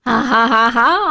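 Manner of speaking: loud gloating "ha ha ha ha"; punched-out ha-has of triumph; triumphant laugh. Real, not acted. Gloats at a correct guess.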